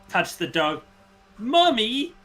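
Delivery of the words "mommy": put-on very high little-girl voice. Little girl tells her mom about a dog, high child voice. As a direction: pitch it up like a delighted small child. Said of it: "the little girl says".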